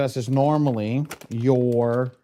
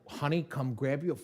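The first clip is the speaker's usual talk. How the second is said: mock exasperated plea; 9 semitones higher than usual. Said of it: "I was like".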